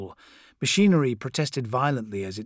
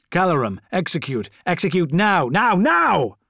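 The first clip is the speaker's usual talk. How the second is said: firm order rising to a high shout; building battle command; 4 semitones higher, 5 dB louder than usual. A narrator's reading. Corvo ordering Calorum to strike, building shout. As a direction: escalate to a full shout on the last word.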